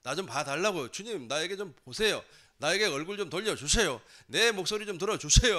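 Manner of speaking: urgent plea building in pitch and volume; acted escalating cry for attention. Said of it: "더 크게 소리 지른단 말이에요".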